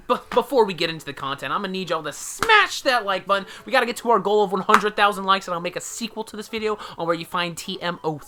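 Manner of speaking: loud, pumped-up YouTuber hype; hyped youtuber voice. Pretend. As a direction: sell it like a clickbait channel host. Hyped parody youtuber intro.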